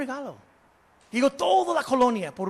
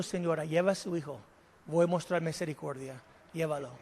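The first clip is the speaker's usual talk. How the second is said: quiet Napoleon; 6 semitones lower, 10 dB quieter than usual. Acted. Napoleon grants mercy.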